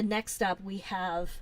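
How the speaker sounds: ordinary talk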